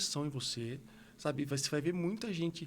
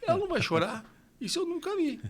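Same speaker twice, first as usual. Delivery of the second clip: put-on higher and louder, amazed the child doesn't cry; astonished nurse; 12 semitones higher, 6 dB louder than usual. Nurse amazed the baby isn't crying, high astonished exclaim. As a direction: gasp it out in disbelief. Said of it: "tirou e falou assim".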